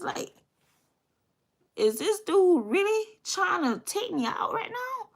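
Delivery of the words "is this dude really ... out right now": much higher, stunned disbelief; can't believe his friend would shoot. Retold or imitated. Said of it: "He can't believe it".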